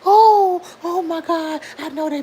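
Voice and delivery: sudden loud, high "oh my God"; a loud, high shriek of glee at the chat; excited outburst. Real, not acted. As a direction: burst out in delighted disbelief. Reacting to a viewer's comment.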